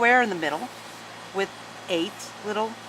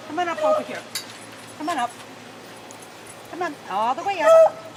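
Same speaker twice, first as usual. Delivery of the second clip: coaxing the dog; 7 semitones higher, faster than usual. Real, not acted. Coaxing dog onto table.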